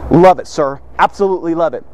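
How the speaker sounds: low, earnest yes-sir answer; always positive. Acted. Employee guarding his tongue before the boss, dutifully positive. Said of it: "always positive, always positive".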